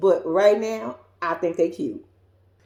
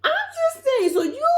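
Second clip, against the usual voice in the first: very high, defensive squeal; high-pitched mock innocence; squeaky protest. Defending her shock at stolen breakfast.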